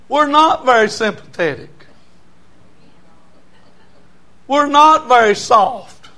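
high, loud preaching. Acted. Preacher teasing the women about men, high and loud. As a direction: lift the voice high and preach it hard.

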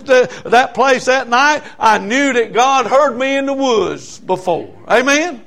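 high, loud preaching; preaching at full force. Acted. Preacher telling the church God heard him. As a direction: press it home.

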